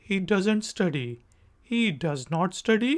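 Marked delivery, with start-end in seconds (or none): 0.1-1.1 doesn't quick and clipped; faster doesn't. Shown as a demonstration. Teacher demoing the faster form. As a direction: run does not into doesn't. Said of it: "और faster form".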